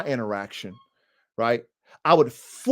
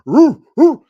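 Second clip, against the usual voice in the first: mean bark; 11 semitones higher, 11 dB louder than usual. Retold or imitated. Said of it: "not that mean bark".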